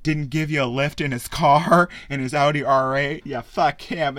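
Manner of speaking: loud, high, hammed-up bar toast; rally the room like a smug showman raising a glass; booming mock toast to a crowd of grudge-holders; mock Mysterio toast. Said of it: "And then he's like".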